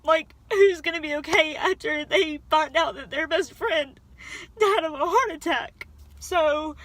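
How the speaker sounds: real crying breaking into the words; fighting tears. Real, not acted. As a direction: push through the tears. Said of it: "I'm really trying not to cry".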